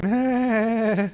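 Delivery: wheezy pained cartoon laugh; Peter Griffin laugh. Real, not acted. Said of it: "Peter Griffin".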